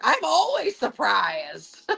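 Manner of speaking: high, slow, drawn-out retort; exclaimed surprise. Retold or imitated. Healer answering Dave's teasing, drawn-out surprised exclamation.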